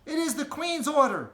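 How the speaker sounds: put-on a firm little girl stating the rule; little girl Sophie. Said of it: "Sophie said".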